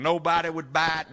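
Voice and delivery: ordinary talk, nothing marked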